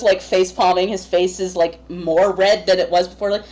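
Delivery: ordinary talk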